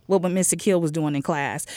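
usual speaking voice

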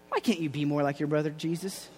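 lightly voiced mom, low and soft; quietly compare him to his brother; mother's chiding. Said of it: "Mary looks at him and she's like".

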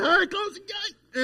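high, loud imitation of a wife's yell; hollering. Wife orders husband about the open gate, imitated holler. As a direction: holler it like an annoyed wife across the house. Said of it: "she just hollered at me".

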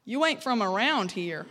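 imitated Southern local; Southern US drawl. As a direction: put on a small-town Southern drawl. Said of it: "this was their response every time to my accent".

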